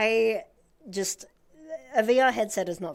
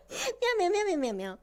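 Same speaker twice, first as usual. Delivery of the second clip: high squeaky meows; cutesy meowing; 10 semitones higher than usual. Acted. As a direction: meow like a tiny kitten. Cute meow string.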